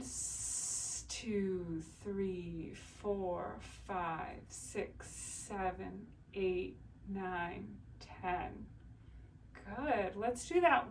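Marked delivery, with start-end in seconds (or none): 0.0-1.0 long, steady hissing exhale; prolonged S hiss. Shown as a demonstration. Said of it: "it's just a prolonged S". 1.1-8.7 soft, evenly spaced counting; steady exercise count. Shown as a demonstration. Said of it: "I'll keep counting".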